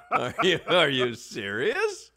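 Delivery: loud, high incredulous "what", then slow questioning; amused, exaggerated disbelief; mock disbelief. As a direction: act out being floored by the comment. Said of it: "I was like".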